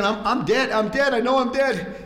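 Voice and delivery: a priest sure he's about to die, hammed-up panic; panicked terror. Priest inside the Holy of Holies, mock panic. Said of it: "he's, he's thinking".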